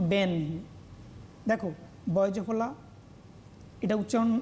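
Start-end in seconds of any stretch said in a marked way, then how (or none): 0.1-0.3 ব্যন as one closed syllable, "ben"; pronunciation demonstration. Shown as a demonstration. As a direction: hold the final n of ব্যন. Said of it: "তখন আমরা কীভাবে উচ্চারণ করলাম".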